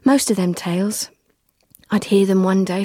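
ordinary delivery

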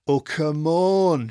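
loud, drawn-out protest; exasperated, stretched-out holler. A narrator's reading. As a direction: holler it slowly with the pitch up. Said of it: "Shadow hollered".

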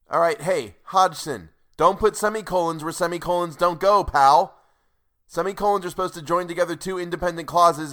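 loud, high, exasperated rant at the author; screaming about semicolons. Real, not acted. Reader yelling at the book's author, angry loud rant. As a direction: scold the writer for a misplaced semicolon. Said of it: "I heard you screaming about semicolons".

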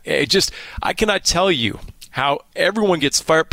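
normal talking voice

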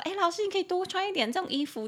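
high, quick imitated student voice; sweet-talking student. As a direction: imitate a student buttering up the teacher. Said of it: "有些嘴比较甜的学生就会说".

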